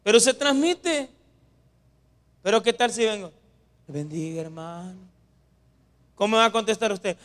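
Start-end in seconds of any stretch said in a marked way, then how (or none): 3.9-5.0 very soft, low, lifeless hello; hushed, unenthusiastic mock greeting; feeble greeting. Shown as a demonstration. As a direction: barely say it, as a listless greeting. Contrast to his shouted greeting.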